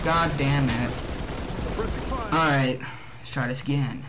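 ordinary talk

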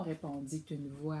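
usual speaking voice

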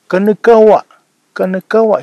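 a father's loud Ute outburst; exasperated father calling out. Acted.

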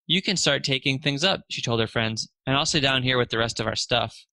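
plain reading voice

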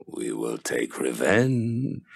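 low, slow menacing threat; menacing giant. Acted. The giant threatens Hindele after she refuses, low and menacing.

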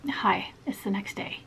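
soft whispered hello; breathy hushed greeting; whispering. Real, not acted. Quiet hello next day.

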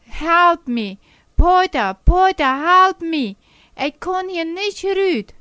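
high, loud desperate cry for help; screaming for help. Acted. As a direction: yell for Peter from the snow. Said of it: "Dann schrie sie". (hoher, lauter verzweifelter Hilfeschrei)

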